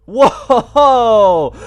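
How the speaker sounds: loud, high burst of amazement; whoa. Real, not acted. Gamer reacting to an awesome cutscene, loud and high amazement. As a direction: blurt it out, genuinely blown away.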